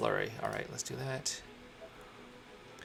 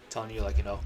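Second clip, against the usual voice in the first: normal talking voice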